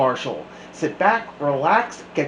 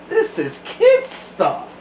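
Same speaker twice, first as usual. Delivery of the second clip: very high, slow whining wail; whiny complaint; 5 dB louder, slower than usual. Acted. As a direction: whine it like a bored kid. Class scorning shadow puppets.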